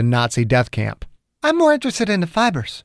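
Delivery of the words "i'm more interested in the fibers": much higher heroine's voice; woman character's voice. Acted. Margaret steers Chang to the fibers, high woman's voice. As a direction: pitch it high, as a female caller. Acted heroine answers on phone.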